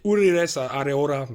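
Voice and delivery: clumsy Spanish accent on a name; attempted Spanish accent. Real, not acted. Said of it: "mon accent espagnol est catastrophique".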